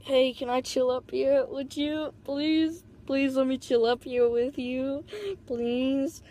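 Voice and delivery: slow, lightly affected pleading; weird put-on voice. Brother begging sister to chill with her, lightly affected wheedling voice. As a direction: beg with drawn-out, rising "please".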